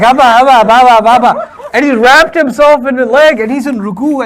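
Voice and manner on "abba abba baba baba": put-on kid calling dad.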